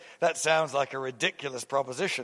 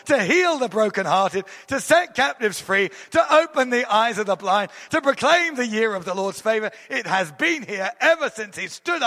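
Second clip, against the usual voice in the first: rising, ringing sermon proclamation, higher and louder; preaching at full force; 6 semitones higher, 4 dB louder than usual. Acted. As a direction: preach it out with mounting fervour to the congregation. Proclaiming the anointing to the church.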